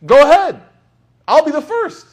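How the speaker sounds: very high, loud bold challenge; defiant shout. Retold or imitated. Imam Malik challenging the visitor, very high and loud. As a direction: shout it out proudly. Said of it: "Imam Malik is like".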